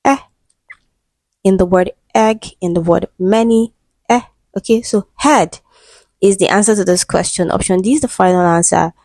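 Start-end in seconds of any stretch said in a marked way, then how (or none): 0.0-0.2 "eh" as one short pure vowel; short isolated vowel "eh"; monophthong demonstration. Shown as a demonstration. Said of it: "is a monophthong". 4.1-4.2 "eh" as one short pure vowel; short isolated vowel "eh"; monophthong demonstration. Shown as a demonstration. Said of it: "is a monophthong".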